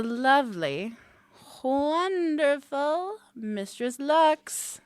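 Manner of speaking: high, slow, savored name; drawn-out announcement. Acted. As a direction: roll the name out lavishly.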